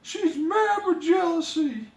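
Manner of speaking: put-on high girlish princess voice; light high young princess, troubled. Princess voice held.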